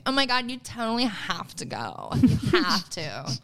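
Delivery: mock bougie enthusiasm. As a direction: gush like a bougie socialite, drawing out the end. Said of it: "that sounds so bougie of me".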